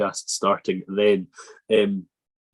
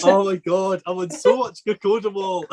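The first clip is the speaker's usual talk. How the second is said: much higher, giggly exclamation; flustered amusement; 9 semitones higher than usual. Real, not acted. Host blaming painkillers for his slip, flustered amusement. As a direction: exclaim high, helpless at your own blunder.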